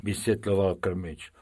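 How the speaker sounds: plain reading voice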